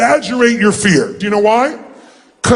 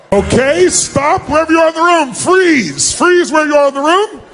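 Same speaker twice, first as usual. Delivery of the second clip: raised voice to halt the whole room; calling out; 6 semitones higher than usual. Real, not acted. Commanding seminar crowd to freeze.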